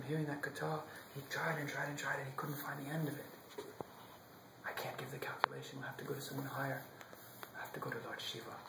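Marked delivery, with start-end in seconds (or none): 4.7-8.5 whisper. Acted. Brahma admits defeat.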